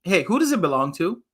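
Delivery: usual speaking voice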